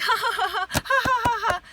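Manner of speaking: high, loud mock evil cackle; imitated demonic laugh. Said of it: "this demonic evil spirit was just laughing".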